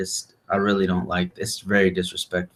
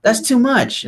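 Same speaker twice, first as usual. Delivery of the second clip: much higher mock-scolding wife; imitating Yuri. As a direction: squeak out her complaint in a mock high voice. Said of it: "But she's like".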